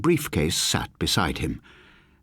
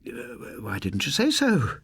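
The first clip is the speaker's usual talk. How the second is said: put-on high, fluttering elderly voice; lightly voiced welcoming old lady; relieved old lady; 5 semitones higher, slower than usual. A narrator's reading. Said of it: "she said".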